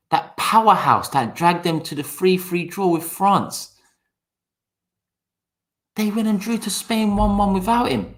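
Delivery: hammed-up sarcastic amazement; sarcastic disbelief. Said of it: "'cause he knows my sarcasm".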